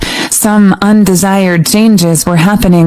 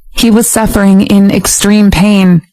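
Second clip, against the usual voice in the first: plain reading voice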